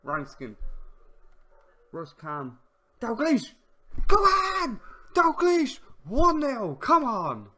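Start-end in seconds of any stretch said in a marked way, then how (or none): 3.0-7.5 loud, high cheering for the goal; yelling with joy, pitched way up; goal celebration. Real, not acted. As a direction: roar it like your team just scored. His team scores a goal.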